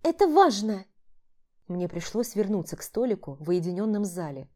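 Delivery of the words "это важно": insistent woman. Acted. Vlada insisting.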